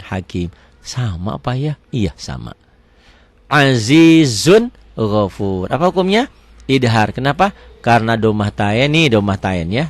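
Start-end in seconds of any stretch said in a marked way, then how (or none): 3.5-5.7 drawn-out, chanted Arabic reading; measured tajwid recitation; slow Quran recitation. Shown as a demonstration. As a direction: recite it slowly, stretching each vowel. Teacher recites Quranic phrase.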